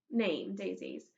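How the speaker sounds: ordinary delivery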